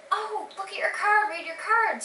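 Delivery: a lightly voiced excited cast member; eager urging. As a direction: nudge her excitedly to open it. Cast urging her to read.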